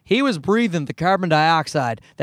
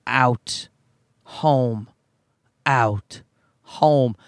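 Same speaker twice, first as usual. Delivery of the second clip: exasperated E T; 4 semitones lower, much slower than usual. Retold or imitated. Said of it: "Like some kind of fucking just exasperated E T".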